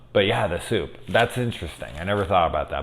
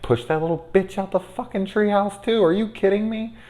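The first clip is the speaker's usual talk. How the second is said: mock furious; 14 semitones higher than usual. Real, not acted. Venting at movie kid.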